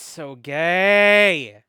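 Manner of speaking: loud, high, stretched-out gush; delighted squeal. Real, not acted. Player gushing over the couple's romance. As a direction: draw it out in giddy delight.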